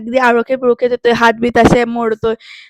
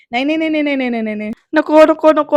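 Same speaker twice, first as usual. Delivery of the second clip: panicky; 3 semitones higher than usual. Retold or imitated. Lights flicker, Vaidehi panics.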